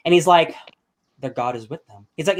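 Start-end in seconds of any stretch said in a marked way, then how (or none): 1.2-2.1 grim defeated murmur. Retold or imitated. Defeated lord's grim answer.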